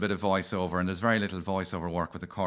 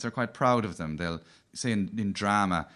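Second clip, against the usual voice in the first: ordinary delivery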